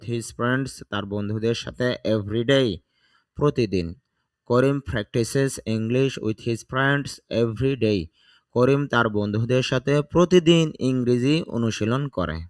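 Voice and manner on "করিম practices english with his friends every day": slow, careful sentence for learners to repeat; slow model reading. Shown as a demonstration. Teacher reading the sentence slowly for students to copy. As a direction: read it out word by word, slowly. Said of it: "teacher reads full sentence slowly".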